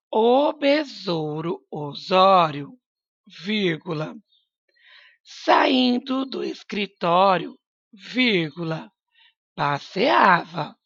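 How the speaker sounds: slow, spaced-out dictation; teacher dictating word by word. Shown as a demonstration. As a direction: dictate each chunk slowly to the class. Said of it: "teacher dictating slowly".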